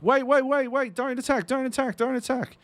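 high, loud, rapid-repeat pleading; hammed-up panicky appeal to hold off; urgent mock plea. Gamer pleads with swordsman not to attack, urgent mock plea. Voices own plea to armed player.

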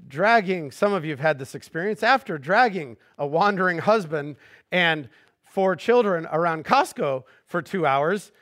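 normal talking voice

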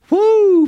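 very high, loud "woo"; sudden ecstatic falsetto cry of praise; joyful whoop. Real, not acted. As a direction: let out one piercing happy whoop.

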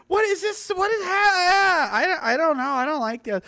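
high-pitched whimpering protest; hammed-up frightened teenager, voice way up high; mock traumatized. Nineteen-year-old grabbed in a pub, mock traumatized whimper. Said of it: "and I was traumatized".

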